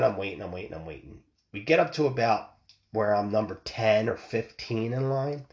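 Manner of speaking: ordinary delivery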